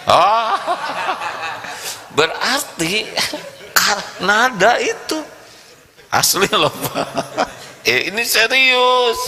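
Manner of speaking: high laughing words; laughing at his own joke. Real, not acted. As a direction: speaks high through laughter.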